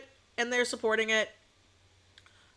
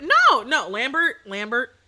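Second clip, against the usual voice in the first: loud protest; 8 dB louder, slower than usual. Real, not acted. Protesting to a chatter.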